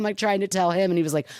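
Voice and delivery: ordinary talk, nothing marked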